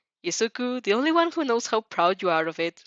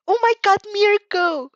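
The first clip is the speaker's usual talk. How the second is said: high, loud excited squeal; 13 semitones higher, 7 dB louder, slower than usual. A narrator's reading. Mina squealing over a hero autograph, excited and high. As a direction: shriek with fan delight. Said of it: "Mina squeals".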